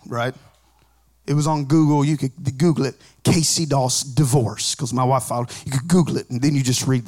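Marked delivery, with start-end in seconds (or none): none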